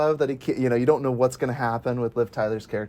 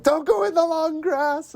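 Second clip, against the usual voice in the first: much higher, slow warning from a film character; Jurassic Park quote; slower than usual. Retold or imitated. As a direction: warn them slowly, high and urgent. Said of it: "it made me think of".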